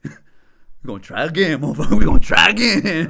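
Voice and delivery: mock pep talk cracking into laughter; loud laughing self-pep talk. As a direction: psych himself up through laughter. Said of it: "I was like".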